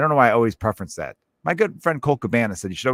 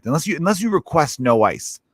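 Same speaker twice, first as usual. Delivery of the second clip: usual speaking voice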